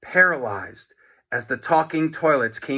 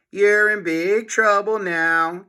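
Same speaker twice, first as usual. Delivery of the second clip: chuckling; 6 semitones higher, 4 dB louder than usual. A narrator's reading. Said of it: "chuckled Missus An".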